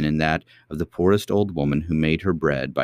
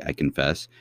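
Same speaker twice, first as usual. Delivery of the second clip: plain reading voice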